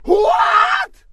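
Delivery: very high, loud outraged yell; asked for a writer's license, outraged disbelief; disbelieving shout. Acted.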